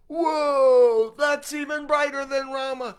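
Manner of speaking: high, loud, wide-eyed amazement; amazed shout. Retold or imitated. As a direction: blurt it out in total awe. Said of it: "you were like".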